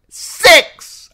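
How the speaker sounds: an aunt screaming about Catelyn; furious shout. Acted. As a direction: scream the number. Lysa shouting.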